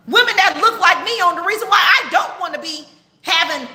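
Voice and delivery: end-times sermon to her live chat, fervent; fiery preaching. Real, not acted. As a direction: preach it at the flock with urgency. Preaches end-times warning to viewers.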